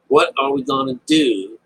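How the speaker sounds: usual speaking voice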